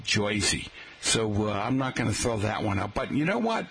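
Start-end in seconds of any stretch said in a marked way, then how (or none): 0.0-0.7 "Jersey" as JOY-see; mock local pronunciation. Shown as a demonstration. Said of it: "They never called it".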